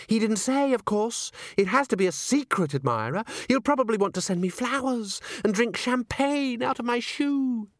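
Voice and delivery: put-on high, breathless-with-news girlish voice; gushing boast. A narrator's reading. Christine tells Agnes about her secret admirer, gushing. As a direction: swoop up high on the thrilling part.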